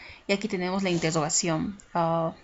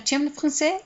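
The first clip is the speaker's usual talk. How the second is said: "Tu aimes le français" as a high, rising casual question; question intonation; 8 semitones higher than usual. Shown as a demonstration. Teacher giving an example of a colloquial question, question intonation. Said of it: "cuando alguien te hace preguntas".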